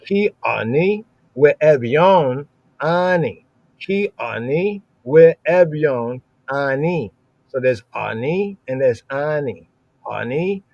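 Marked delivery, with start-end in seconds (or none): 0.0-7.1 slow, careful Hebrew recitation of Ki ani we evyon ani; slow verse recital. Shown as a demonstration. Teacher reads the Hebrew verse aloud to students, slow and careful. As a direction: read out Ki ani we evyon ani slowly, each word clear. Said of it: "Hear the verse again".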